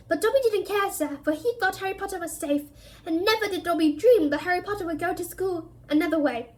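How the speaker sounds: high, pleading little elf; house elf voice. A narrator's reading. The elf reproaches Harry, high and pleading.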